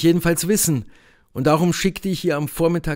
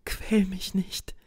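slow, strained whisper; laboured whisper; 6 semitones higher, 8 dB quieter, slower than usual. A narrator's reading. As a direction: whisper it with effort, pleading. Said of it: "flüsterte sie mühsam". (mühsames, langsames Flüstern)

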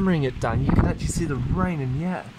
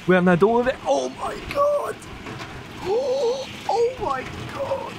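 excited. Real, not acted. Rain intensifies again.

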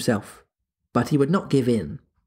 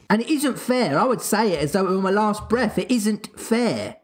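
put-on childish protest; 8 semitones higher than usual. A narrator's reading.

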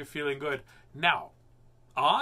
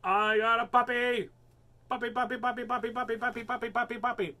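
high, gleeful sing-song; excited; 9 semitones higher than usual. Real, not acted. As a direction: chant puppy in delight. Excited over new puppy toy.

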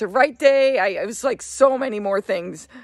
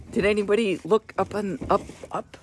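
normal talking voice

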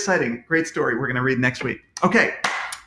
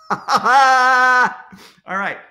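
laughing shout; 8 semitones higher, 7 dB louder than usual. Real, not acted. Laughing after own joke.

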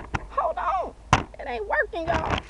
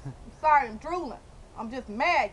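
ordinary talk, nothing marked

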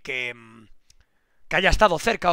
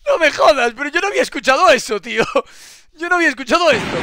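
higher and louder, amazed, the last line fast; can't believe what he just heard; stunned disbelief; 8 semitones higher, 9 dB louder than usual. Real, not acted. To chat, amazed by the singing flood reporter.

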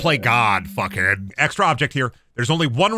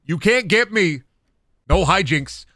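ordinary talk

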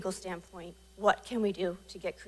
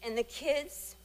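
choked up; 5 semitones higher, 5 dB quieter, slower than usual. Real, not acted. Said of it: "I'm getting choked up".

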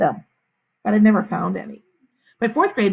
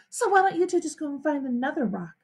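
higher, lightly haughty; lofty dismissal; 6 semitones higher, 4 dB quieter than usual. A narrator's reading. Sheila sends the boys off her rock, high lofty dismissal. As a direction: dismiss them as if you own the park. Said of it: "she says, if she owned the park".

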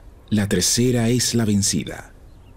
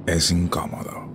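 whisper. Acted. Girl finds sofa uncomfortable.

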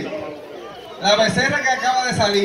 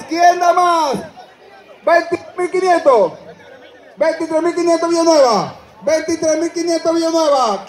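auctioneer; 5 semitones higher than usual. Acted. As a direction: drag out the going once, twice. Auction calling bids.

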